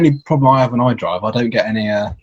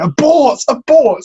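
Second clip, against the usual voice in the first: high, loud retold yell of "Abort!"; shouting; 12 semitones higher, 3 dB louder, slower than usual. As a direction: yell it like calling off a mission.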